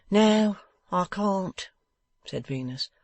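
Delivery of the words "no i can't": pitched up and drawn out, curt; higher, slow curt reply. A narrator's reading. Venus refusing Wegg, slow and high.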